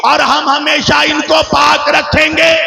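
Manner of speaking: high, ringing oratorical argument; full-force preaching. Real, not acted. Preacher argues the verse's double wording to the congregation, full-force preaching. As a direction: build the argument in sustained proclamations.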